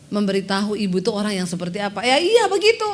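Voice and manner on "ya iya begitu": curt retort. Retold or imitated. Rude woman answering curtly.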